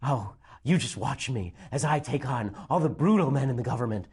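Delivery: lightly voiced, mock-heroic Meryl Streep; a hint of a self-important Meryl Streep; sneering parody of the trailer's heroine. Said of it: "Meryl Streep".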